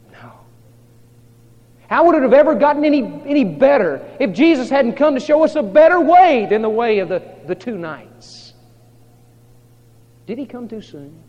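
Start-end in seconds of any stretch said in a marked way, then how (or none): 1.9-8.5 loud, high, fast preacher's outburst; full-force preaching. Acted. Preacher challenging the congregation about Jesus, full force. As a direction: fire off the argument at full force.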